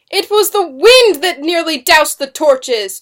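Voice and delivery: high, loud, slowed protest; cried protest. A narrator's reading. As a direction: cry out against the accusation. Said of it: "Susanna cried".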